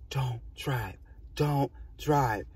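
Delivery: imitating an apparition's whispery warning; soft, hushed words, spaced out like a phantom. Vision of mom warns the driver, in a hushed voice.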